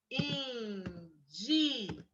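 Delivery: indigna drawn out very slowly; slow dictation of the wrong word. Shown as a demonstration. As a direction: read indigna syllable by syllable to the class. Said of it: "A professora vai escrever".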